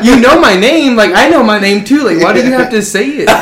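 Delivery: high and loud, through laughter; baffled, laughing it out; laughing disbelief. Retold or imitated. Retelling his baffled reaction, laughing.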